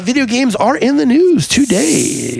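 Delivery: high, slow, stretched-out news intro; singsong announcer delivery; showy announcer. Pretend. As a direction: draw out the headline like a hammy presenter. Mock-grand segment intro.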